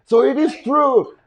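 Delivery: high, loud, slow mock accusation; imitated ex. Ex catching him out on the phone, imitated high and slow. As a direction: draw it out high, mimicking her realization. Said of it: "And she's like".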